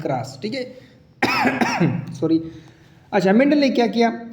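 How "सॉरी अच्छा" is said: usual speaking voice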